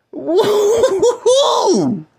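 high, slow laughing woo-hoo; laughing whoop. Real, not acted. Celebrating the halftime lead, high laughing woo-hoo.

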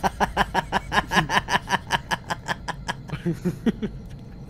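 laughing. Real, not acted. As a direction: laugh hard at your friend. Laughing at friend's scream.

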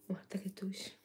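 soft whisper beside a sleeping dog. Real, not acted. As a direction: barely breathe the words.

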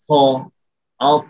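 ফ with air escaping, the mistake; airy wrong p. Shown as a demonstration. Teacher demonstrating the common error of airy p. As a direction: breathe out through ফ to show the error. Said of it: "এভাবে উচ্চারণ করি".

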